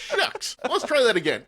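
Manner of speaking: high, hammed-up aw-shucks voice; mock disappointment. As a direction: play a comically thwarted negotiator. Joking as the thwarted negotiator.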